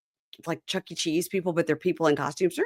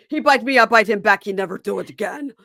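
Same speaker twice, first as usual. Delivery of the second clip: hammed-up Eastern European broken English, louder; mock Eastern European accent; 4 semitones higher, 5 dB louder than usual. Imitating Andre's bragging in a mock Eastern European accent. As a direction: boast like a proud Eastern European dad. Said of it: "laughing after mock Andre".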